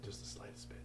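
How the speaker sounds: faint pleading whisper. Acted. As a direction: breathe it as a tiny plea. Pleading for a little water.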